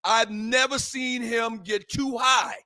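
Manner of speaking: ordinary talk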